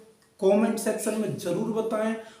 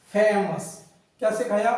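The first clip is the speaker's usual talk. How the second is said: ordinary talk, nothing marked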